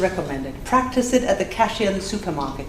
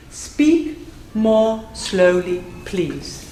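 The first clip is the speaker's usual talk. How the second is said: slowly, word by word, dropping away at the end; much slower than usual. Shown as a demonstration. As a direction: space out each word. Models the meaning by slowing down.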